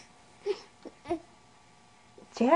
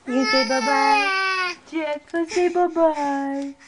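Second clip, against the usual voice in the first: slow coaxing; slower than usual. Real, not acted. Coaxing baby to say bye-bye.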